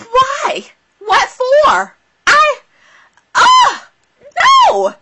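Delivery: high, loud wail of disbelief; rising, loud protest; dismayed outcry. Real, not acted. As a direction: cry out "no!" in shocked dismay. Dismayed outburst at the show.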